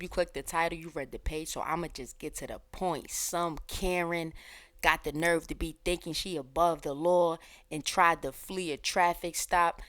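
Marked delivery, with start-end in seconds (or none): none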